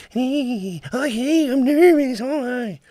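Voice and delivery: very high, loud nervous puppet; squeaky jittery radio puppet voice. Shown as a demonstration. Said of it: "I was doing the nervous voice".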